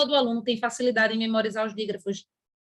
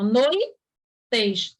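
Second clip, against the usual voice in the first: very slow; 2 semitones lower than usual. Shown as a demonstration. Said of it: "tem que ficar juntinho".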